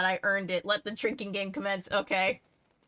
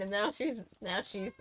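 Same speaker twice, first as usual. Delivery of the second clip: plain reading voice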